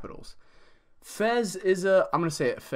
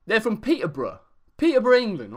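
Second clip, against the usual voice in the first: a put-on English accent from Peterborough, higher and louder; fake British accent; 6 semitones higher, 6 dB louder, faster than usual. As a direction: say it like a cheeky bloke from England. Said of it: "I'm gonna do the rest of the video in this accent".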